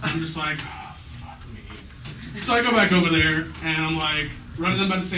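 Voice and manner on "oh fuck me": a soft, voiceless whisper; whispered dread. Retold or imitated. Teen seeing the girl's tear, whispering in dread. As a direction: breathe it out in dismay. Sees her single tear.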